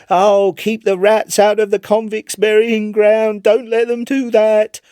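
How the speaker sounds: high, loud frantic cry of a haunted man; crying aloud. Acted. Chips crying aloud at his club at night, high and loud. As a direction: cry out high and loud as if the rats are right there. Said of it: "he would cry aloud".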